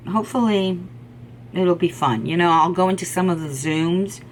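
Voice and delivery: ordinary delivery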